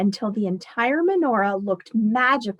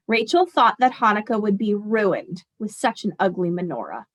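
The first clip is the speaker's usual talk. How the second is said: usual speaking voice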